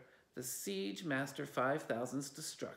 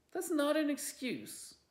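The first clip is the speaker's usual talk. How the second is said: protest; 13 semitones higher, 4 dB louder than usual. Acted. Felicity protests.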